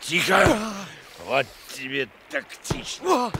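high sudden shout; sharp shout. Acted. Korniukha shouting in the struggle, sharp shout. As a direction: snap it out mid-fight. Fight, hissing command.

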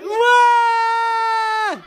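high, long comic wail; scream. A narrator's reading. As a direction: howl it as if spun on a stool. Daddy spun on stool screams.

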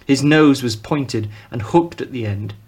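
plain reading voice

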